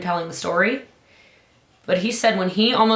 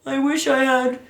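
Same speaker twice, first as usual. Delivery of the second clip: slightly higher, lightly acted lament; mock deathbed wish; 4 semitones higher than usual. Speaker mimicking someone on their deathbed, mock wistful regret. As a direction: play a hint of idle regret. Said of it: "You're not gonna be saying".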